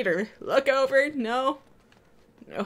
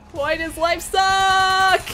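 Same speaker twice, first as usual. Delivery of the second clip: mock despairing wail; 11 semitones higher, 6 dB louder, much slower than usual. Real, not acted. Overwhelmed, drawn out wail.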